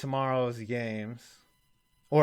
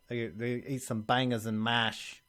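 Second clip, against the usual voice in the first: mock British accent; 3 semitones lower, 7 dB quieter than usual. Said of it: "I don't know what kind of accent that was".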